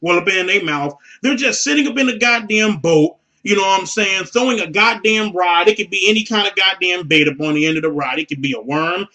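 ordinary talk, nothing marked

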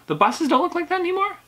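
high-pitched puzzled disbelief; incredulous question. Real, not acted. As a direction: repeat it back as an astonished question. Reacts surprised to the letter's claim.

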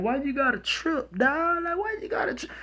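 very high, thin whine of protest; mock pleading. Man begging his angry woman to calm down, mock pleading. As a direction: imitate a man whining in falsetto.

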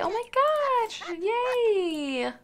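high, loud happy "Yay!"; gleeful birthday cheer; excited cheer. Pretend. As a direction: squeal with joy.

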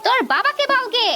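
high-pitched, sneering dismissal; scornful scolding. Acted. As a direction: taunt the child while ordering them out. Aunt throws child out, mocking. (তাচ্ছিল্যভরা চড়া গলায় বকুনি)

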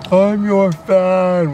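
high, slow, loud creepy fan. Pretend. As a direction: drag it out like an obsessed fan. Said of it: "we're gonna call you creepy".

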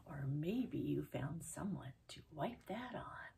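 hushed secret whisper; playful whisper. Acted. Conspiratorial aside to kids.

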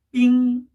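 "삥" high, loud and level; first tone. Shown as a demonstration. Teacher modelling the word's first syllable, "삥" high level tone. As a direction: hold "삥" on one high note. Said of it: "냉장고라는 단어 일 성".

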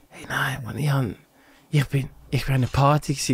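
mock-downcast friend, low and soft; subdued confession. Friend admitting to a party during covid, subdued.

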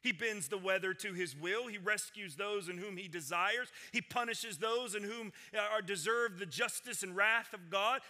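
ordinary talk

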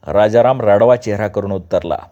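ordinary delivery, nothing marked

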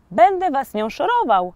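mildly proud and loud; proud announcement. A narrator's reading. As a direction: announce it puffed up with pride. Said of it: "z dumą".

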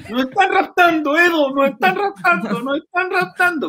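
very high hammed-up panic; mock panic. Drunk passenger faking kidnapping panic, very high. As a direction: squeal it like you're being kidnapped. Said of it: "empecé a gritar".

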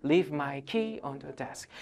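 very slow and a bit soft; slow model. Shown as a demonstration. Teacher slowly modelling the sentence for students. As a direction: draw each word out gently. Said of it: "시작".

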